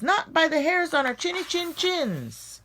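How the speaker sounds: high, squeaky piggy refusal; little pigs' voice. Acted. Little pigs refusing the shark, high and defiant. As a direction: chirp it back up high, defiant.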